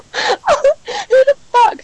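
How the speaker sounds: crying with laughter; slow, high laughing question. Real, not acted. Laughing at farmer.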